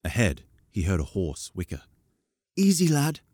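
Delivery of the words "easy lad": put-on boy's voice. A narrator's reading. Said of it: "a boy's voice said".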